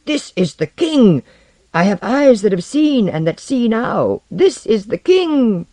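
loud, high, fierce cry; fierce proclamation. A narrator's reading. Old follower to those still unmoving, fierce. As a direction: declare it fiercely, drawing out the key words. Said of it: "spoke fiercely to those who still stood unmoving".

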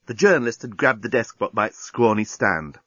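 ordinary delivery, nothing marked

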